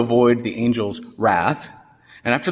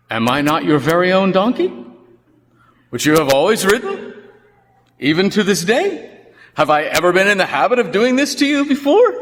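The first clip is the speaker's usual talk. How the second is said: lightly voiced donkey, higher and patient; reasoning donkey; 7 semitones higher, 4 dB louder than usual. The donkey reasoning with Balaam, a touch higher. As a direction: reason gently with your master. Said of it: "the donkey reasons with Balaam".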